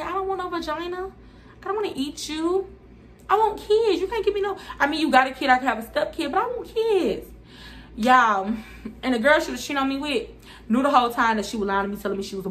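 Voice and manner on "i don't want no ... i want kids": high pitched protest; retold refusal. To the girl begging, a high indignant refusal. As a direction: squeal it back at her. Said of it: "I'm like".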